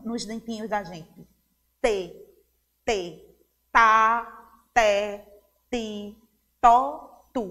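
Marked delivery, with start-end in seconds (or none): 1.8-7.4 syllables with T, tongue touching the teeth; língua nos dentes. Shown as a demonstration. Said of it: "a língua toca no". (sílabas com T, língua nos dentes)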